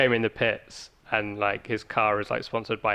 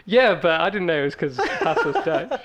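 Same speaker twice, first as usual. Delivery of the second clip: laughing; 6 semitones higher, 4 dB louder than usual. Real, not acted. Laughing at own mistake.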